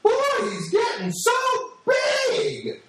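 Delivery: very high, hammed-up squeal of amazement; gushing woman. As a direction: squeal over how the child has grown. Gushing woman exclaims over boy.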